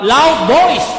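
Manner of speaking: preacher calling out loud voice. Shown as a demonstration. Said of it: "Praising God in a loud voice".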